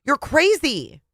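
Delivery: husband yelling at his crying wife; yelled. Acted. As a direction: spit out the insult. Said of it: "he yelled again".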